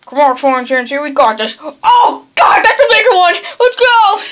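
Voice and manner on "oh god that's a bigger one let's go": loud, high burst of alarm; urgent raised-pitch yell; alarmed shout. Real, not acted. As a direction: shout out in a panic. Bigger threat appears.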